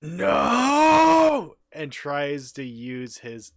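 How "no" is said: long, high, loud cry of no; anguished yell. Acted. Paladin watching the forest burn, anguished yell. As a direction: yell it out and hold the vowel.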